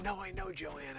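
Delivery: usual speaking voice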